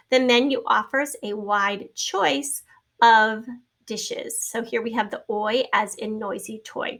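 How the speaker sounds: ordinary talk, nothing marked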